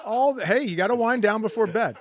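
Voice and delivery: ordinary talk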